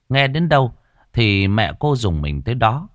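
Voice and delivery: usual speaking voice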